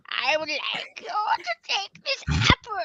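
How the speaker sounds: high, slow storybook witch; crone. Pretend. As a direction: offer it like a wicked old crone. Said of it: "I wanna be a crone".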